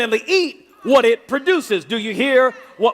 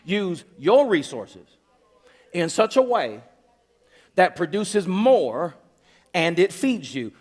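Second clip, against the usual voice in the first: slow emphasis; 4 semitones lower, slower than usual. Shown as a demonstration. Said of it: "You, you use".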